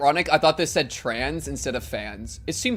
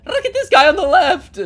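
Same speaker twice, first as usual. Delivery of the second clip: loud, high, laughing yell; excited shout; 10 dB louder than usual. Real, not acted. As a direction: shout it out with glee. Excited reaction to game creature.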